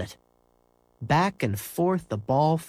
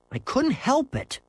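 scowling back; 14 semitones higher than usual. Acted. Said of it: "Henry scowled back".

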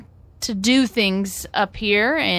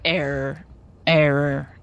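lower, repeating robot error alert; glitching robot; 5 semitones lower than usual. Pretend. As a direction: stutter out error like a broken robot. Joking about AI and humans.

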